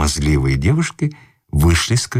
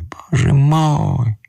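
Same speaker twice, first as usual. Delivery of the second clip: slow, high anguished wail; high drawn-out lament; drawn-out cry; 10 semitones higher, much slower than usual. Acted. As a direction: stretch out the despair. Distressed man exclaims twice.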